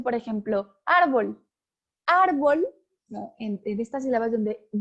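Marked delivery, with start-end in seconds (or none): 0.8-1.5 árbol with the stressed ÁR pushed out; stress on first syllable. Shown as a demonstration. Teacher showing where the accent falls, stress on ÁR in árbol. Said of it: "Si yo pronuncio". 2.1-2.7 árbol with ÁR punched higher and louder; exaggerated first-syllable stress. Shown as a demonstration. Teacher demonstrating emphasis for accents, ÁR of árbol strongly stressed. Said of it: "hay más énfasis".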